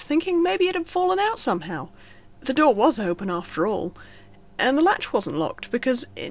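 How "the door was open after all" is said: plain reading voice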